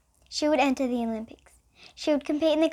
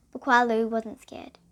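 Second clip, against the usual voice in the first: plain reading voice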